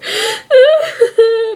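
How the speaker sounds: loud, high mock-tearful gasp; emotional gasp. Viewer reacting to Harold's crying, loud high overcome gasp. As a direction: gasp as if bursting into tears.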